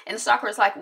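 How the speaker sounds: usual speaking voice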